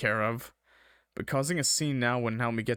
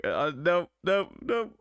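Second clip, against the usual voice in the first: ordinary talk, nothing marked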